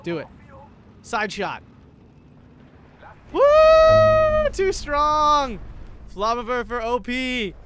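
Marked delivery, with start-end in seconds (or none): 3.3-7.6 loud, high triumphant cheer; excited shouting. Real, not acted. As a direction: cheer it like a big win. Celebrating a flamethrower kill.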